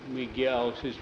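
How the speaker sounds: plain reading voice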